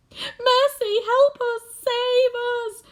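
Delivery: high, loud panicky little bug voice; ladybird crying out. A narrator's reading. Ladybird panicking as the figure descends, high frightened cry. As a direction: squeal for mercy in terror. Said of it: "cried the ladybird".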